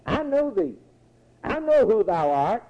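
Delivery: high-pitched cry of recognition, acted; preacher lifts the pitch for the demon's cry. Demon confronts the Holy One of God, high acted cry. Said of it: "the unclean spirits are crying out".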